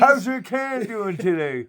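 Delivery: high silly can voice; a beer can cheerfully greeting Josh; voicing the beer can. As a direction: give the can a goofy voice.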